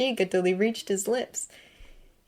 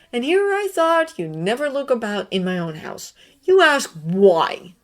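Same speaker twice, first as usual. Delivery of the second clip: retorting; 6 semitones higher, 6 dB louder than usual. A narrator's reading. Severus snapping back at Dumbledore, sharp retort. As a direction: throw the question back at him. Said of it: "retorted Severus".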